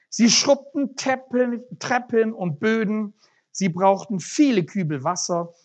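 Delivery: lively storytelling read to the congregation; story read aloud. A narrator's reading. Preacher reading a parable to the church, lively storytelling. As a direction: read the story with animated character voices.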